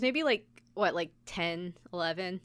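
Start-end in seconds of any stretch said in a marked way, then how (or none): none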